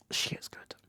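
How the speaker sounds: barely voiced, very soft whisper; awed whisper. Real, not acted. Reviewer praising the actress's performance to viewers, awed whisper. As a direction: whisper it as if overcome with admiration.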